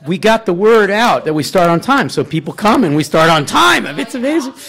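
loud, high, emphatic proclamation; excited boast. Real, not acted. Host boasts about the venue's punctuality, excited boast. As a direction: crow it proudly to the room.

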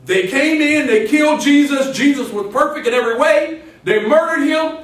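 high, loud preacher's proclamation; punchy, rising pulpit delivery; forceful preaching. Acted.

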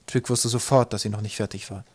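plain reading voice

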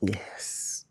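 soft whispered yes. Real, not acted. Husband feeling the baby kick, whispering. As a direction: breathe the word out softly.

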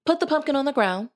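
high, fast fairy giving orders; fairy godmother. A narrator's reading. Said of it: "said the fairy godmother".

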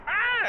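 short, high, loud scream; sudden high-pitched yelp of fright; mock scream. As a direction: imitate a sudden group shriek. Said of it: "Everybody starts screaming".

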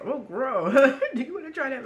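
loud, fast teasing dare; teasing excited. Retold or imitated. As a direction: tease yourself, quick and loud. Teasing inner voice.

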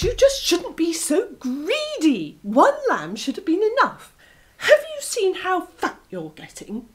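high, teasing fox voice; a sly vixen lecturing; scolding fox. Acted. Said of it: "said the fox".

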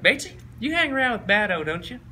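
high-pitched coach impression; Spurrier. Coach checking on a player about his friend, high imitation. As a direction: question him in a high coach voice. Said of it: "He's like".

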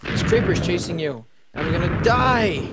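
slow, loud mock panic; hammed-up dying wail; panicked. Creepers chasing them.

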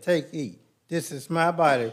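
ordinary talk, nothing marked

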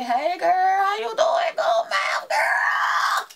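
put-on loud, slow hey girl shouted high; excited shout. Vlogger acting out a fan hyping her up loudly. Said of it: "just say".

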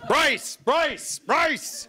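high emergency brace command; mock brace call. Inner crew bracing for action.

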